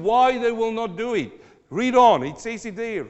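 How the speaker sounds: high, loud exhortation from the pulpit; impassioned preaching. Real, not acted. Preaches at full force.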